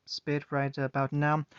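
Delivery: usual speaking voice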